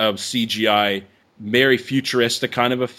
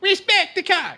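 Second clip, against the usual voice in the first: much higher squeaky voice; higher pitch. Pretend. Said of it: "in a higher pitch".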